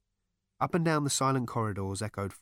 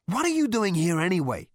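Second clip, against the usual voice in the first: loud, high challenge; raised voice, pressing for an answer; demanding; 9 semitones higher, 7 dB louder than usual. A narrator's reading. Zaphod challenges Rooster on why he is there, demanding. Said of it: "demanded Zaphod".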